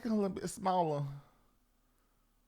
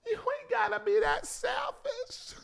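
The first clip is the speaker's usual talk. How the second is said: high slow shout; scolding shout; 12 semitones higher than usual. Real, not acted. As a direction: yell it at them.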